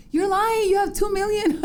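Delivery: put-on high, accusing child voice with a laugh; insistent kids. Daughters telling their mom she is famous, in high, accusing kid voices. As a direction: call Mom out like a disbelieving kid.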